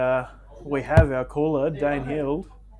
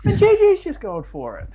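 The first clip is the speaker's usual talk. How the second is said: laughing; 13 semitones higher, 7 dB louder than usual. Real, not acted. Laughing at his own mistake.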